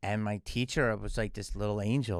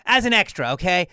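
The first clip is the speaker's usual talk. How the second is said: high, loud "As an extra, okay?"; a grand lady losing patience; high and loud, talking down; impatient explaining; 8 semitones higher, 10 dB louder than usual. Retold or imitated.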